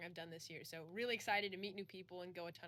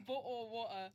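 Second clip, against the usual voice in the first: put-on British accent; 2 semitones higher than usual. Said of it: "the common phrase that we always hear as British".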